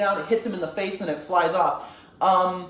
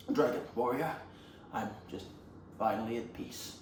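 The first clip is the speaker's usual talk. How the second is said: low, soft, halting master; gentle mock dying-master voice; acted weary peace; 7 semitones lower, 10 dB quieter than usual. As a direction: murmur it with long pauses, spent. Weak master speaks to Po.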